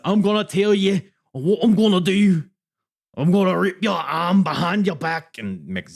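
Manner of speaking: loud, high-pitched Scouse threat; badly faked Scouse wrestling-heel rant; Liverpool accent. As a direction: belt it out in hammed-up Scouse. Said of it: "being berated for my accent".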